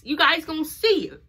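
normal talking voice

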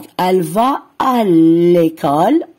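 teacher modelling "va" word by word; slow model reading. Shown as a demonstration. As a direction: read "elle va à l'école" slowly and clearly. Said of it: "نعيد".

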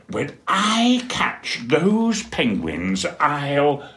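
angry penguin. Acted. Dudley furious at door.

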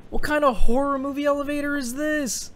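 high, loud spooked question; alarmed by the eerie elevator; nervous alarm. Real, not acted. Player stepping into a creepy elevator, high and alarmed.

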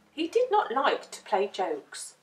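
ordinary delivery

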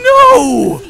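high, loud cry of no; dismayed yell. Real, not acted. Dismayed outburst in game.

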